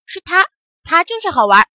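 put-on loud, high children's tattling; single him out loudly; eager accusation. A narrator's reading. Children pointing out the culprit to old monkey, accusingly.

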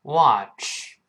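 American "watch" with a wide open ah; American pronunciation. Shown as a demonstration. Teacher contrasts accents for students, American open vowel in "watch". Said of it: "美国人口型大一点".

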